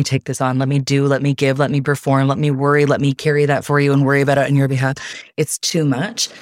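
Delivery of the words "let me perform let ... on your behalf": normal talking voice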